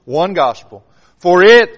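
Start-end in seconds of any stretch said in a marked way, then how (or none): 1.2-1.7 "for it" with it punched hard and loud; the word "it" hammered home; stress on it. Shown as a demonstration. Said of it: "look at the word it there".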